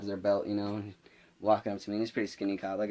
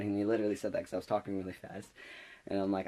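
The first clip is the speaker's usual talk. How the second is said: usual speaking voice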